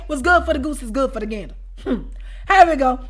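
ordinary talk

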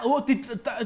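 normal talking voice

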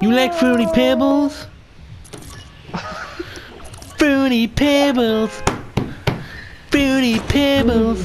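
high, drawn-out silly voice; playful put-on voice. Player teasing a young gamer about cereal, silly put-on voice. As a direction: stretch the cereal name out, high-pitched and slow. Teases child player with catchphrase.

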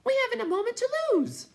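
very high, loud cry; high excited cry. A narrator's reading. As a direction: leap up in alarm crying out. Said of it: "it cried".

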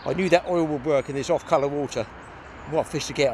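ordinary talk

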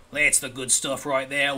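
put-on drawn-out, playful Australian accent. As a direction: put on a slow Aussie accent. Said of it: "doing an Australian accent".

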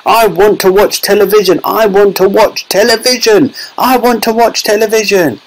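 put-on high, tiny boy's whine; squeaking tantrum. A narrator's reading. Shrunken boy demands television from parents, squeaky tantrum. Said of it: "he squeaked".

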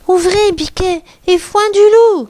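high call of a mother goat; mother's high, ringing call; crying out. A narrator's reading. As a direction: call out the password, rising high on the last words. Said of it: "crie la mère".

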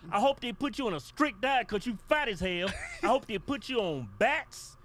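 loud, high, laughing curses; laughing rant. Acted. Cursing Santa for making his little boy cry, loud and laughing. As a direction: spit laughing curses at him.

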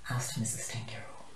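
usual speaking voice

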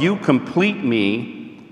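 slowly drawn-out quote of you complete me. Shown as a demonstration. Speaker contrasting movie line for couples, slow. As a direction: stretch out "you complete me" word by word.

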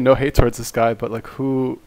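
usual speaking voice

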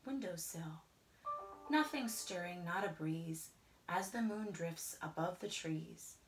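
usual speaking voice

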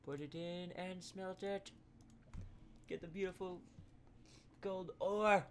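high, slow awe-struck voice; awed. Real, not acted. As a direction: marvel at the treasure. Reverent wonder at gold ore.

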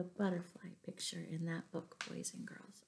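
soft unvoiced whisper. Real, not acted. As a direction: share a little secret with the class. Teacher confides to class after story.

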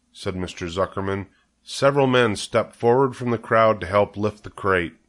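usual speaking voice

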